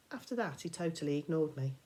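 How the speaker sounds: plain reading voice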